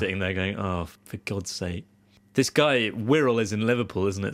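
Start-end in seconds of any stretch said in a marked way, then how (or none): 0.6-1.8 slow, low, muted grumble; weary exasperation. Retold or imitated. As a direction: grumble it softly, as a fed-up teammate. Bored teammates grumbling, acted.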